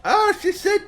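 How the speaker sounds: high-pitched, muddled grandma voice; unsure grandmother. Acted. Grandma telling her granddaughter what she heard, unsure. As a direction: play an old lady who misheard.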